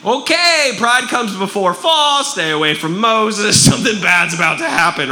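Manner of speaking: high, loud mock warning to the crowd; mock alarmed warning. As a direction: warn everyone off with a laugh. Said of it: "you would be like".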